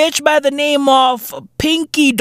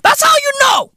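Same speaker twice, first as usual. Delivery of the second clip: ranting shout. Real, not acted. Comedian shouting the punchline to the audience. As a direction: yell the punchline. Climax of rant.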